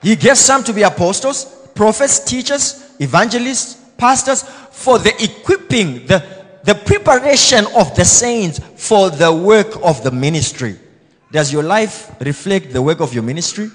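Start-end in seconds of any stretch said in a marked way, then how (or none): 0.1-10.8 loud, high, ringing sermon delivery; preaching at full force. Acted. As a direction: proclaim each calling to the church. Preaching the gifts list.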